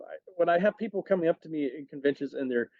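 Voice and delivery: normal talking voice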